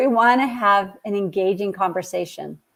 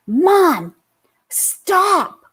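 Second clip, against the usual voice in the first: put-on high, sharp cry of stop from a frustrated teen; imitating the daughter snapping at mom; daughter blurting out; 8 semitones higher than usual. Daughter cutting off mom in the car, sharp and high. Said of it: "She just blurted out".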